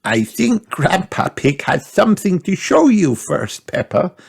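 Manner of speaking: put-on granny gently redirecting the child; Granny speaking. A narrator's reading. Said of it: "said Granny Pig".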